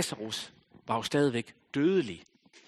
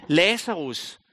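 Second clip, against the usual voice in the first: calling out with a raised voice; Jesus calling Lazarus from the grave, raised voice; loud call; 2 semitones higher, 7 dB louder, slower than usual. A narrator's reading. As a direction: call him out of the tomb. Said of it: "råbte han med høj røst".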